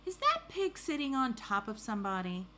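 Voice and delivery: lightly voiced officer wondering aloud; puzzled officer. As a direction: read it as a character's puzzled question. Officer's question, read aloud.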